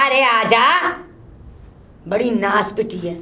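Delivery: normal talking voice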